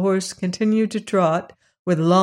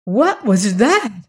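frightened; 4 semitones higher, 4 dB louder, slower than usual. A narrator's reading. Just after an earthquake.

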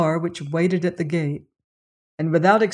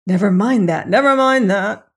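a quick, loud cut-in that climbs higher; interrupting; 3 semitones higher, 6 dB louder than usual. A narrator's reading. Doctor cuts off Saunderson to hear the servant, urgent and louder. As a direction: brush the point aside, urgently. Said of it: "interrupted Doctor Cairn".

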